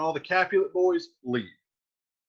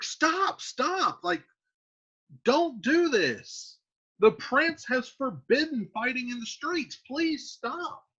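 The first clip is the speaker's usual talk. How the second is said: high, urgent pleading to stop the fight; pleading Benvolio; 9 semitones higher, slower than usual. Retold or imitated. Benvolio begs the duelists to stop, high pleading. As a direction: beg them to stop, voice pitched high. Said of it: "So he's like".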